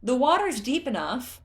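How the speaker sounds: shouted up. Acted. Tula shouts up to Groove on the tower, loud. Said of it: "Tula shouted to Groove".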